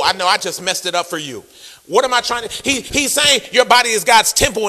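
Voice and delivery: ordinary talk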